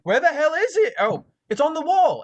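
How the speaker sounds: high, loud searching professor; flustered professor. Acted. Professor looking around for the book, flustered and loud. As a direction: play a professor hunting about in a fluster. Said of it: "and he's like".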